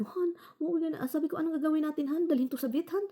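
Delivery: high, urgent anxious questions; panicked pleading. Retold or imitated. Asking her husband what to do with the cat, panicked. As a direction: beg for a plan in panic.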